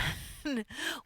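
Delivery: short, soft laugh; a brief chuckle to oneself; amused chuckle. Real, not acted. Coach amused by paper it's not printed on, soft chuckle.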